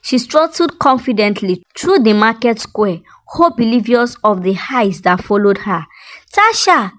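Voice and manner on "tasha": sharp high call, name snapped out. Acted. Woman in the market calling out to Tasha sharply. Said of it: "The sharp voice made her freeze".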